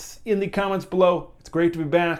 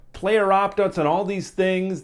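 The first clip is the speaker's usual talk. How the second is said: ordinary talk, nothing marked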